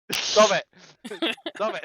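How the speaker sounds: high, loud protest; mock scolding. Real, not acted. Telling the players to cut out the noises, high and loud. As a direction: cry out to stop the teasing.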